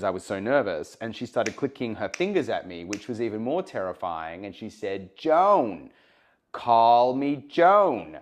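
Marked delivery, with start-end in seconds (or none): 5.1-8.1 imitating Joan Rivers, slow loud American drawl; a loud, drawn-out American accent as Joan Rivers. Guest imitating Joan Rivers telling him to call her Joan, in an American accent. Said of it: "That's a good American accent".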